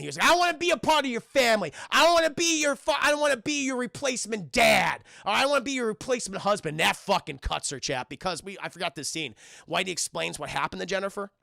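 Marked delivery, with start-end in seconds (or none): 0.3-6.8 loud, high, rapid-fire rejection; heated acted yelling; angry outburst. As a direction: lash out at a pleading friend. Adam angrily rejects the family.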